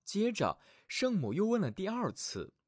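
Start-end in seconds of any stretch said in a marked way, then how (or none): none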